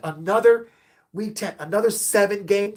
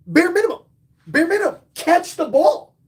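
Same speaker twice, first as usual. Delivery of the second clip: catchphrase bit; 7 semitones higher than usual. Shown as a demonstration. Streamer doing his chat bit, higher and hammed up. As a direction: pitch it up like a running gag for chat. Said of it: "I do that bit on my chat".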